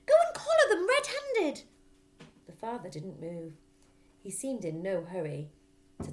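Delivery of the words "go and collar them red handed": very high, loud pushy voice; wife prodding husband to act; urgent prod. Acted. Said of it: "hissed the mother".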